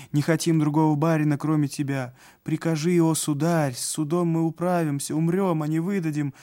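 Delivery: high, loud pleading cry; crowd shouting. A narrator's reading. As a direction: cry out, begging your master. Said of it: "кричали они".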